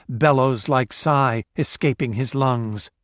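ordinary delivery

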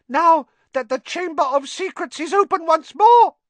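very high, loud, fervent elf voice; squeaky house-elf building to a dire warning; Dobby. A narrator's reading. House-elf warns Harry of danger at Hogwarts, high and impassioned. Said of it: "Dobby froze, horror-struck".

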